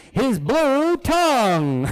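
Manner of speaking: BLUE TONGUE hugely drawn out; dramatic reveal. Acted.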